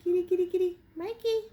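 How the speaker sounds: high, loud sing-song kitty call; high loud cat call. Real, not acted. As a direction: call the cats high and bright. Calling cats.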